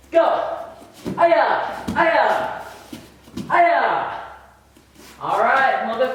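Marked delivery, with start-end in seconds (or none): 1.2-4.2 three high, sharp martial arts yells; kiai shouts. Shown as a demonstration. Instructor yelling kiai as the class goes faster. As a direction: snap each aya out with the strike.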